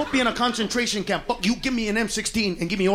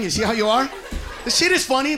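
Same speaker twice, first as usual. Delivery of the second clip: ordinary talk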